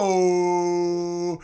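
high, loud, stretched name call; roaring ring-announcer finish; announcer climax. Acted. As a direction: belt the name to the rafters. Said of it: "you hear it".

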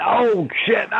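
shocked high yelp into laughter; just got slapped. Real, not acted. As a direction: yelp then crack up laughing.

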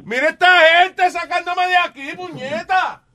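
high yelled complaint; hollering, hammed-up indignation. Complains loudly in the bit. (queja gritada y aguda)

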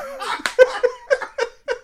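high-pitched burst of laughter. Real, not acted. Big laugh after quote.

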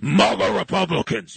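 mock deep-voiced announcement of maga Republicans; lightly imitating a solemn deep voice; a hint of a booming press secretary. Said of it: "they say it in a deep voice".

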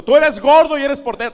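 high, loud blunt verdict; mock kids' verdict. Schoolkids overruling the fat boy, loud blunt verdict. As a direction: shut down the argument bluntly. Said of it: "Les decía".

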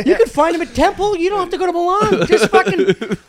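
mock mom. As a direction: gush like a proud mother, pitched high. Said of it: "my mom's been talking about".

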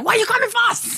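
high squeaky imitation of the uncle; a high, put-on voice scolding his son; high mock uncle voice. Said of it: "he was like".

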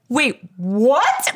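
stunned question with a sharp rise; freaked out. Retold or imitated. Couple hears about the golfing friend, freaked out. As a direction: retell a shocked double-take. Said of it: "we are so freaked out".

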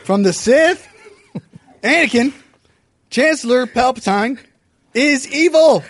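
dramatic shouting. Acted. Obi-Wan argues with Anakin.

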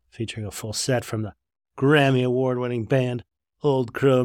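folksy promo announcer read. Acted. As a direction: sell the event like a radio spot.

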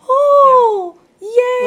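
high, loud squeal of joy; squealing excited yay. Retold or imitated. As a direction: squeal it out, thrilled. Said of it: "And I was like".

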